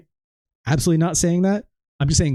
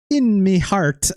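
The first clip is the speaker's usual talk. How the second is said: higher, drawn-out Irish lilt; mock Irish accent; 6 semitones higher, slower than usual. Dynamite Kid talking as if he was Irish, mock Irish accent. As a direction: stretch it out in a put-on Irish brogue. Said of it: "as if he was Irish".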